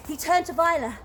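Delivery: usual speaking voice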